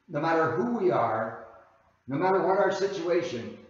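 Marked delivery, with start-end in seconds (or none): none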